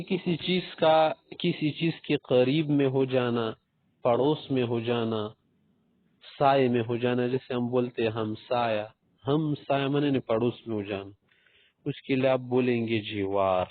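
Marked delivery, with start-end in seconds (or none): none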